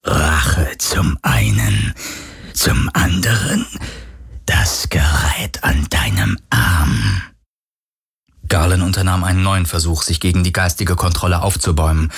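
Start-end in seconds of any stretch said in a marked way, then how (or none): 0.0-7.2 low, slow whispery phantom; ghost voice. A narrator's reading. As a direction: draw out each phrase like a menacing spirit. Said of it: "fragte die Geisterstimme". (tiefe, langsame Geisterstimme)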